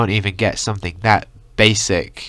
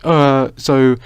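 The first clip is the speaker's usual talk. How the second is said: higher, hammed-up sad vlogger voice; mock sad YouTuber; 4 semitones higher, 3 dB louder than usual. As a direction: parody a self-pitying YouTube confession. Mocking sad YouTube confessions.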